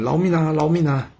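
high pleading cries; begging for mercy. A narrator's reading. Captured men beg general Yi for mercy, pleading cries. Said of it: "大家一直喊".

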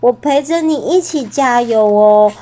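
plain reading voice

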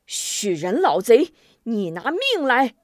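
drawn-out furious challenge; enraged yell. A narrator's reading. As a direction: roar it as you charge. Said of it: "大叫一声".

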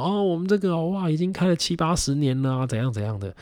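put-on high-pitched chatty old auntie voice; lively Taiwanese grocery auntie up high; shopkeeper auntie. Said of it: "然后阿姨就说".

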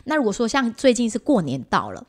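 ordinary talk

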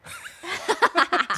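long high laugh falling away. Real, not acted. Teasing a friend about their last cry, a long high laugh.

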